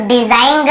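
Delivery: डिजाइन ग with an extra "ga" at the end; wrong sounded g. Shown as a demonstration. Teacher shows what not to read, डिजाइन ग ending in hard g. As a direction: say डिजाइन ग as "di-zain-ga". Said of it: "ऐसे ग को नहीं पढ़ रहे".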